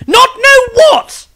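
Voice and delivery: exploding frustration. Acted. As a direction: burst out after years of waiting. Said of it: "almost exploded with ... frustration".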